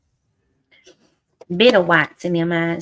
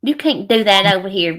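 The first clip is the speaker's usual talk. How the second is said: usual speaking voice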